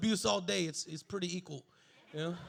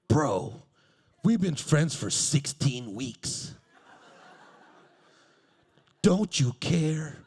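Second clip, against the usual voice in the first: deep, loud, slow plea; stalker voice, slow; 6 semitones lower, 6 dB louder, much slower than usual. Retold or imitated. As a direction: drag out the stalker's whine.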